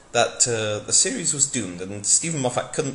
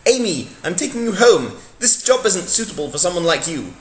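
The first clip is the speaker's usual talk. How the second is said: louder, higher heroic Doctor; hammy Doctor; 5 semitones higher, 7 dB louder than usual. Acted. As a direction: declare it like a melodramatic hero. Doctor sends Amy home.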